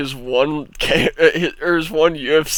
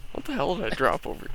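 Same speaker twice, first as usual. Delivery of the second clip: normal talking voice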